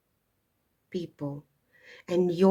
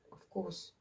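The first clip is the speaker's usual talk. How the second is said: usual speaking voice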